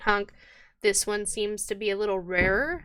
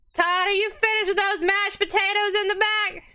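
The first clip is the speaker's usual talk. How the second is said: high, carrying call toward the back room; calling to the kitchen; 12 semitones higher, 3 dB louder than usual. Acted. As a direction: shout it back to the cook, pitched way up. Barmaid calls to kitchen.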